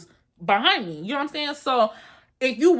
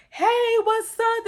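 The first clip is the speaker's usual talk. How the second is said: high, slow sing-song hey; mock hyped greeting; bubbly greeting; 11 semitones higher, much slower than usual. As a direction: greet like an excited old friend. Friend greets Pillow excitedly.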